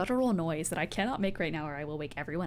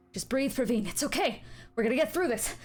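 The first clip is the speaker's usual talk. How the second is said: higher, louder, urgent reassurance to a wounded woman; frantic man comforting; 9 semitones higher, 4 dB louder than usual. Acted. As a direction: desperately soothe her while panicking. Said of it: "the sound of a man".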